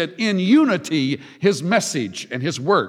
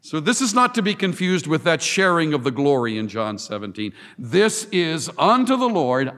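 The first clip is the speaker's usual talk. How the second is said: ordinary delivery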